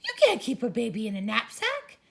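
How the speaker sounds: teacher scolding. Acted.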